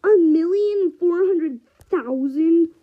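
loud, high disbelieving yell; shocked outburst. Real, not acted. Gamer yells in shock at his huge score. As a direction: blurt it out in shock, stretching the number.

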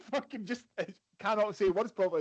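normal talking voice

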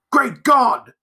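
high, loud cry of horror; horrified exclamation. A narrator's reading. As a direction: gasp it out, shocked to the core. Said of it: "he uttered the exclamation of".

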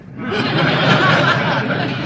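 studio audience laughs out loud; audience laughter. Real, not acted. Studio audience laughing at the dinner joke. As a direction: crowd laughing.

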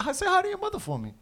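very high, quick actor impression; Mark Wahlberg. Host does the actor's catchphrase, high and quick. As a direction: rattle it off in a high mock voice. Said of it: "You do a better Wahlberg".

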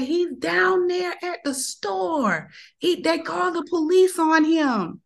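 put-on high, slow urgent girl voice; young girl voice. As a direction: voice the girl telling news. Girl reports boy's location.

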